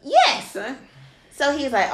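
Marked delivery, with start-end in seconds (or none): 0.0-0.6 high, loud burst of agreement; emphatic yes. Real, not acted. Agreeing with her friend, a high loud emphatic yes. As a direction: fire it out, fully agreeing.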